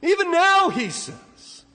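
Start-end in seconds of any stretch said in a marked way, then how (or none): 0.0-0.7 loud, high-pitched pulpit cry; fiery preaching. Acted. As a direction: thunder it from the pulpit. Preacher at full force.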